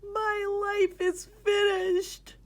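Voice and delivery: high, drawn-out cry of ruin; wailing shepherd. Acted. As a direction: wail in despair. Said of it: "wailed the shepherd".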